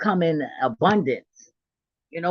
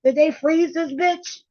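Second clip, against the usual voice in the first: retold shocked disbelief; 13 semitones higher than usual. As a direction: gasp at how young she still looks. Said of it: "I was like".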